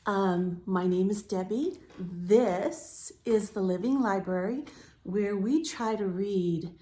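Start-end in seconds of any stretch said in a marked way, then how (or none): none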